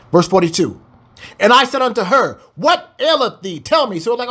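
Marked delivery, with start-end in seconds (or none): 1.4-4.0 scripture reading. Acted. Reads scripture aloud.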